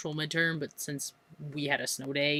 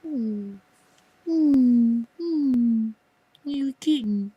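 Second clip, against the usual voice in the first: high, cooing pet talk; cat-lover coo; 7 semitones higher, 5 dB louder, much slower than usual. Real, not acted. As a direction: coo at the kitten.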